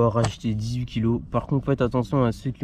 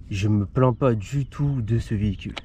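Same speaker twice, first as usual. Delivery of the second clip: normal talking voice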